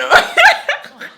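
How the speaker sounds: high, loud giddy laugh; a loud squealing laugh at herself. Real, not acted. Giggling after the crush joke.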